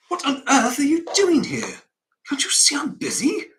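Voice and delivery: high-pitched, loud, flustered scolding; startled. Acted. George to children bursting into his office, startled and flustered. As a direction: demand an explanation, voice shooting up. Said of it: "George is startled".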